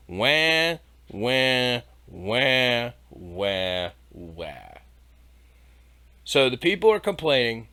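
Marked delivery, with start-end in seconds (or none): 0.1-4.8 whiny fake wah sobs fading low; mock baby crying. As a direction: wail like a bratty baby, dying out. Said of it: "mock crying after the reading".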